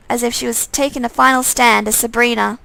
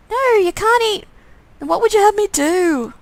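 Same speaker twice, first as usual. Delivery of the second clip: much higher plea; alarmed protest; 9 semitones higher than usual. Pretend. Player protesting the twin eating the dog, high. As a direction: stop him, pitched up.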